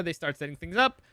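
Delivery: usual speaking voice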